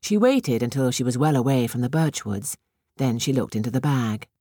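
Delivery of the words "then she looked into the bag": usual speaking voice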